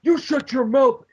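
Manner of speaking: imitating a wrestling boss snapping at Eve; mock angry snap. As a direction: bark it like a furious boss. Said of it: "he's like".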